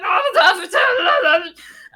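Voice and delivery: high, loud wordless panicked wail; imitating a wordless panicked yelp; terrified wail. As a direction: let out a strangled scared cry. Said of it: "He was just like".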